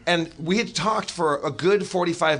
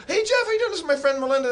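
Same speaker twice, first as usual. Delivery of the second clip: put-on very high, chirpy girl's voice; mock her high-pitched, eager hello; excited greeting; 12 semitones higher, faster than usual. Girl greeting Jeff to introduce her friend, very high and chirpy.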